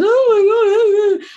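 high, loud whiny moan; moaning Myrtle voice. Retold or imitated. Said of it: "this little voice as the moaning Myrtle".

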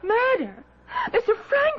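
high-pitched, aghast questioning; shocked. Acted. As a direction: recoil at the accusation, voice leaping up. Shocked at murder accusation against fiancé.

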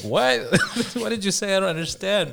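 high, loud puzzled voice; confused reply. Retold or imitated. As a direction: act baffled, laughing at first.

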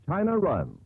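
ordinary delivery

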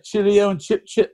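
hammed-up posh English, high; mock English accent. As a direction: play a silly upper-class Englishman, pitch up. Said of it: "do the English accent".

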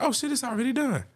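high, surprised retelling; mock amazed exclaim. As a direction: act out the surprise, pitched high. Retold own surprised reaction.

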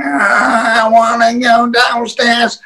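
high, drawn-out protest; hammed-up wailing refusal; mock drunken wail. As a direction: imitate a drunk man whining his refusal. Retold drunk man refusing the stairs.

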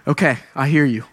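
low, deflated acceptance; resigned. Retold or imitated. Youth pastor conceding to the Lord, resigned. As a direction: give in reluctantly after a sigh. Giving in to the Lord's correction.

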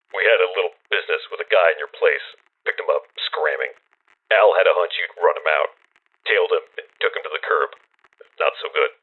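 rapid, clipped phone report; fast. Acted. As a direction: rattle it off fast, no feeling. Anonymous caller reports the hit.

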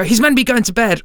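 a mock high-pitched scolding voice; imitating his wife Kerry. Kerry telling him off over bedtime wrestling, mock high scold. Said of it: "and then Kerry would go".

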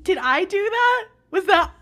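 high, loud comic disbelief; mock startled question. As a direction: imitate someone taken aback by what they caused. Acting out Cantum's startled question.

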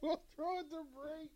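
very high, squeezed-out words through laughter; near-crying with real laughter; helpless laughter. Real, not acted. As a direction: struggle to speak while cracking up. Helpless laughter going to break.

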